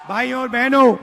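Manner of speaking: usual speaking voice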